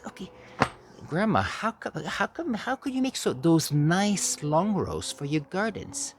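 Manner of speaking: put-on high, wondering child's voice; little kid voice. Child asking grandma about the garden rows, high and wondering. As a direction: ask it like an amazed small child. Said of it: "And all I could see was".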